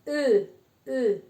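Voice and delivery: rounded eu vowel, lips like o saying E, E; French rounded e. Shown as a demonstration. Teacher demonstrating the rounded French vowel. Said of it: "la E así, una".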